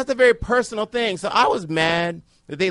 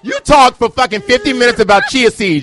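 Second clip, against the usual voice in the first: loud, higher-pitched outburst; angry retort; 4 semitones higher, 8 dB louder than usual. Real, not acted. Comic fires back at co-host's interruption, angry shout.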